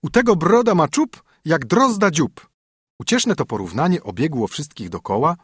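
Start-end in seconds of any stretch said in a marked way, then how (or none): 0.0-2.5 high, louder jeering rhyme; mocking call. A narrator's reading. Princess jeering at a king's chin, loud and high. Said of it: "zawołała".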